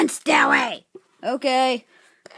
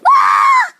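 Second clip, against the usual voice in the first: very high, loud mock scream; 8 dB louder than usual. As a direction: shriek as the car goes wild. Crash during toy drive.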